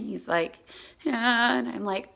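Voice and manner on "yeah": mock tearful kid, higher and louder; crying child. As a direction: imitate a child answering through tears.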